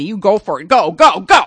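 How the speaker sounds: loud cheer, building up. Pretend. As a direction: egg them on with a shout. Cheering someone on.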